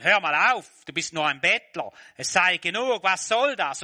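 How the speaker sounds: loud, high rebuke; scolding crowd. Acted. Crowd telling the beggar to stop, loud rebuke. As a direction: shut him up, sharply and loud.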